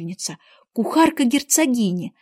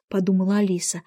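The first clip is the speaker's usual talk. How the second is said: usual speaking voice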